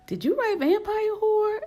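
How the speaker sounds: high, excited fanboy question; giddy question. Retold or imitated. Agent asking the author about her book, high excited. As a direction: ask like a thrilled fan.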